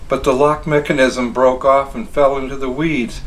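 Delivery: ordinary delivery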